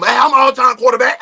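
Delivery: put-on 2 hollers it like a kid calling for the ball; 3 loud boastful playground call; 1 playful shout. Imagines himself playing outside.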